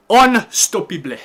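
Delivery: loud, high, drawn-out boast; triumphant shout. Real, not acted. Streamer celebrating a late winner, slow triumphant shout. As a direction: shout it slowly, stretching it out.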